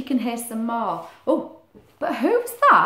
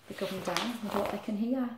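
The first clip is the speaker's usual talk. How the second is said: ordinary delivery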